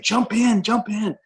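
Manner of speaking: high, bubbly coaxing to jump; giddy urging. Retold or imitated. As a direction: urge them in like a giddy kid. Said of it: "he is like just giddy".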